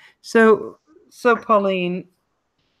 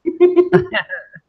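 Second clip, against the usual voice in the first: high burst of laughter; 6 dB louder than usual. Real, not acted. Laughing at naughty chat names.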